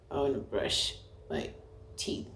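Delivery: weak, very quiet, drugged voice; drugged mumble. Retold or imitated. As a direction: act too groggy to speak up. Imitates herself groggy on Pethidine.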